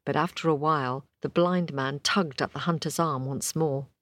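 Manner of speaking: usual speaking voice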